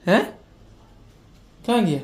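high, coaxing searching voice; playful mock search. Playing hide and seek with a little child, high and coaxing. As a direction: pretend to hunt for auntie to thrill the child.

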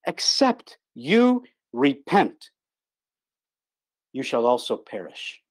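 very slow, each word weighed; solemn scripture quote. Acted. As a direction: spell out "Except you repent" slowly, then sink on "perish". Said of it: "these are the words of Christ now".

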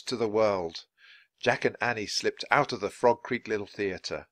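ordinary delivery, nothing marked

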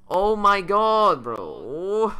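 loud, high, drawn-out groan of despair; anguished wail. Real, not acted. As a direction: wail it out slowly, close to despair. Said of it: "I actually want to cry".

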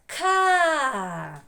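Ка alone, high, loud, with a long а; syllable sounded out. Shown as a demonstration. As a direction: ring out a drawn «Каа». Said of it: "Другий склад". («Ка» голосно й протяжно)

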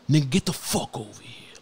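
gruff hammed-up command; pit bull dad. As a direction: imitate a big bully dad snapping at his son. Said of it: "they look like fucking pit bulls".

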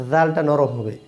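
ordinary talk

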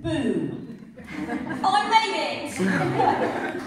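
high, loud, slow triumphant laugh through the words. Real, not acted. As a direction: crow it out laughing, hugely pleased.

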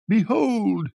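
high, drawn-out showman's cry; high-pitched patter call; charlatan's shout. A narrator's reading. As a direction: hawk it like a fairground quack. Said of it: "the charlatan was shouting".

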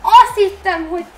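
screaming, loud and high in real joy; ecstatic shout. Real, not acted. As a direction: yell in genuine disbelief at the goal. Real thrill at long-range goal.

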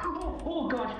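high startled gasp; startled cry. Real, not acted. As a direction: yelp in fright. Sudden fright.

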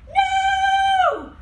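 long, very high, loud wail of refusal; horrified shout. Acted. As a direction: cry out in protest, held on one high note. Simon refuses in horror.

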